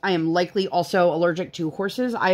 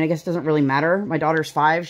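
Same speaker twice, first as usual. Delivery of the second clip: ordinary talk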